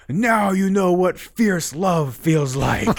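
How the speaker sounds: high, slow, drawn-out proclamation; voice from infinity. Retold or imitated. Retelling an otherworldly voice from infinity on fierce love, in a slow, high boom. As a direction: proclaim it grandly, stretching the words out. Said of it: "I hear this voice from wherever".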